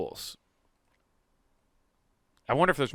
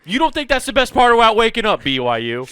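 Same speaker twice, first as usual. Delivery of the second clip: loud, high, fast sales pitch; mock coffee ad; 7 semitones higher, 7 dB louder than usual. Mock Folgers jingle pitch.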